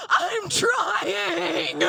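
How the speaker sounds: loud, high strained cry; hammed-up loud straining wail; strained husband. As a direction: push the words out like straining hard. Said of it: "And Brad says".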